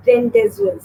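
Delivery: rendezvous read as spelt, "ren-dez-vus"; rendezvous mispronounced as written, high and clear; common mispronunciation. Shown as a demonstration. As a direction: say rendezvous wrongly, sounding out the Z. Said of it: "people usually pronounce it as".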